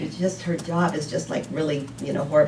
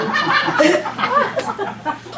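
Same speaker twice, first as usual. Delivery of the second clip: a sudden high embarrassed laugh; high burst of laughter. Real, not acted. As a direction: let a helpless laugh break out. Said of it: "I really messed that up".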